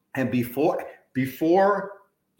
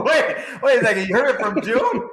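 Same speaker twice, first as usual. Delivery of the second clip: laughing surprise; 10 semitones higher, 4 dB louder than usual. Real, not acted. Host laughing at surprising news.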